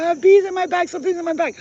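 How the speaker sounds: high, loud panicked yell. Retold or imitated. To the cab driver with bees stinging her back, panicked yell. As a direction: shout in pain and alarm. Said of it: "I said".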